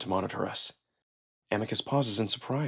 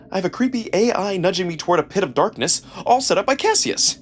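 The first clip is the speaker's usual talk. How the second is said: rising alarm; 8 semitones higher, 6 dB louder than usual. A narrator's reading.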